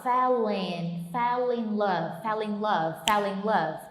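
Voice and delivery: fell in love run together; linked drill. Shown as a demonstration. Teacher drilling the linking for students. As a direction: repeat fell in love smoothly. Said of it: "então ele fala".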